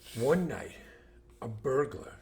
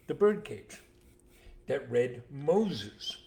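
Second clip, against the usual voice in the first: ordinary delivery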